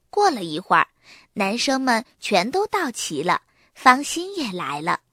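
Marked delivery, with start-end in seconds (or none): none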